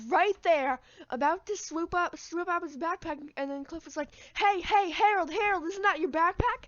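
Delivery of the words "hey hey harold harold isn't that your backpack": loud, high mock call across the yard. Cliff calling Harold about his backpack, loud high shout. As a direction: shout his name to warn him.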